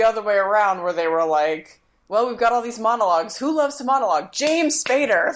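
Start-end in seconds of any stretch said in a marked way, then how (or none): 2.1-5.3 higher-pitched imitation of eager casting people; mock excited brainstorming. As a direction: pitch it eagerly, stretching out the name. Voicing casting people having an idea.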